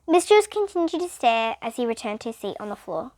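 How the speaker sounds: ordinary delivery, nothing marked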